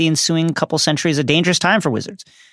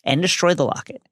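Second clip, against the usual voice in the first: normal talking voice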